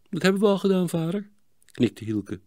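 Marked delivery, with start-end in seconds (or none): 0.1-1.3 put-on high-pitched young boy's answer; dutiful boy. Boy reassures his scolding father, dutiful. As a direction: answer father like an obedient child.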